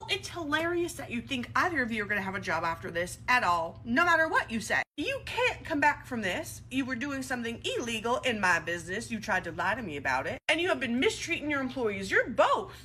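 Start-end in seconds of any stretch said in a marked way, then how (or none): none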